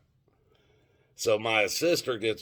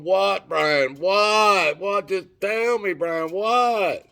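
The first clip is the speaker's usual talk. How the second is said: frantic sister; 10 semitones higher, 5 dB louder, much slower than usual. Retold or imitated. Sister frantic for bad news.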